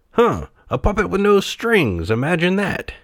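high, amused character voice; marvelling toy. A narrator's reading.

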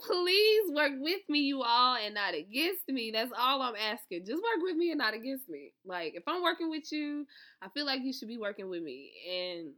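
ordinary talk

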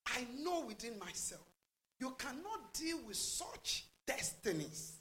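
ordinary talk

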